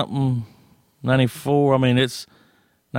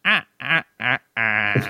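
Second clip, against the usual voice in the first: a light imitation of a creepy child's little laugh; short eerie staccato giggle; creepy kid laugh; slower than usual. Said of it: "I would do that little laugh".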